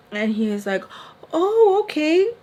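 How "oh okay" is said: high, louder, put-on agreeableness; super friendly. Man suddenly agreeing at the door, imitated friendly. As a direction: chirp it in mock innocence. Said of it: "Change of tune".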